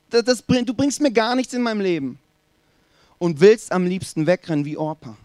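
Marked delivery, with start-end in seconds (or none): 0.1-2.2 high-pitched, exasperated reproach; frustrated complaint. Acted. Sufferer complaining to God in a bad situation, exasperated. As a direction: throw a fed-up accusation at God.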